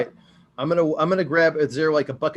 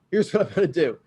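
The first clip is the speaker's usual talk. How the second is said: ordinary talk, nothing marked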